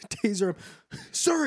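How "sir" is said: high, sharp mock command; mock cop warning. Officer warning the crackhead, in a mock high command. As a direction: call out like a nervous cop. Police officer tasing suspect.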